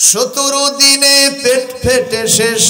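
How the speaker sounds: high, loud warning cries; preacher warning the congregation at full force; fiery preaching. Acted. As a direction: thunder the warning at the crowd.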